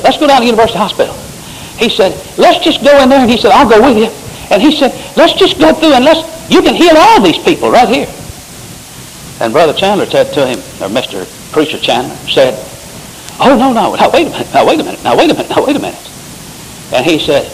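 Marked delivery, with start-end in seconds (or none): 0.0-8.1 excited retelling. Retold or imitated. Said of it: "I got so excited about it". 13.4-16.1 much higher, hurried protest; a preacher backpedaling in alarm; flustered pastor. Retold or imitated. Pastor backing out, retold.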